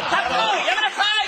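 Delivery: frantic dad scolding; man scolding child. Retold or imitated. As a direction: yell at a kid in a store. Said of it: "って言って".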